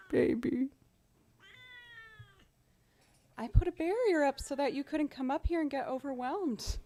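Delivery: soft high coo to the cat; pet talk. Real, not acted. Talking to her cat.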